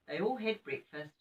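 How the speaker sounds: ordinary delivery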